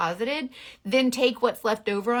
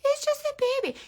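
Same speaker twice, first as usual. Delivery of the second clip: put-on baby talk; faster than usual. Shown as a demonstration. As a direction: coo it like talking to an infant. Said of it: "if you actually say that out loud".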